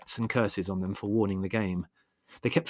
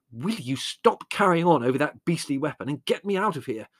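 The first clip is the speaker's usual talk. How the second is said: higher, louder, scathing demand; exasperated, biting question; vitriolic; 6 semitones higher, 5 dB louder than usual. A narrator's reading. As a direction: demand rescue with acid impatience. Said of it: "asked Larry vitriolically".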